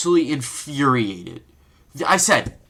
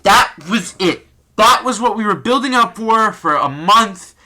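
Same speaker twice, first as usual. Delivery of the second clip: loud indignant emphasis; 6 semitones higher, 6 dB louder than usual. Real, not acted. Fan ranting that the month of build-up was wasted, loud and indignant. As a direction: lean hard into that in anger. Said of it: "repeated louder".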